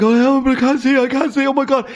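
imitating a terrified blinded friend; panicked cry. Retelling Robbie's fright to the host, high mock panic. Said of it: "Robbie's like".

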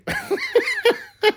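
very high real cackle; long squealing laugh at your own joke; big laugh. Real, not acted. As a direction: collapse into high-pitched laughter. Host laughs at own question.

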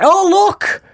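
high-pitched burst of mock excitement; excited. Spotting a family name on a gravestone, excited. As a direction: exclaim it like an excited discovery. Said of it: "I get right excited".

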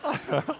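high, soft laugh; real amused laugh. Real, not acted. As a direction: laugh at your own joke. Laughs at own joke.

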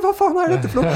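high, loud mock outburst at the traffic; exaggerated impatient honker shouting at cars; mock road rage. Angry driver honking in queue.

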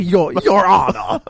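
slow, loud Southern courtroom drawl; southern lawyer. Pretend. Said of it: "you have to do it in the voice of a Southern lawyer".

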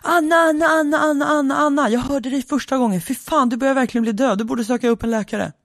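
high, mocking echo running into a scolding; hammed-up high-pitched wifely rant; exasperated wife. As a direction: fire back as the fed-up wife. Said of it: "Och varpå hon svarar".

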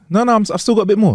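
high, fast, flustered refusal; high fast reply. Retold or imitated. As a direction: rush the no like you're caught out. Retold exchange over food.

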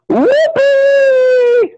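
very high, long squeal; sky-high celebratory cry; jubilant whoop. Acted. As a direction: whoop with hammed-up joy. Chris celebrates getting the show.

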